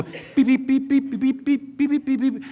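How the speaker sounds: high mock beeping; playful telegraph beeps. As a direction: beep it out like a telegraph key. Imitating telegraph signal.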